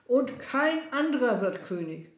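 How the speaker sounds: high, loud insistence; raging, self-important tyrant; shouting king. A narrator's reading. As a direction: insist on the crown, loudly. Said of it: "schrie Herodes".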